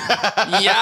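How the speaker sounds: high, giggling yeah; laughing parrot mimic. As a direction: squawk it through a laugh. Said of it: "we start going".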